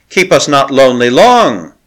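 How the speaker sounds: a light taunt at the doomed pair; mild mocking. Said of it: "the priestess mocked".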